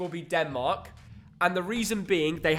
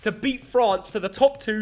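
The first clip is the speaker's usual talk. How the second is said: normal talking voice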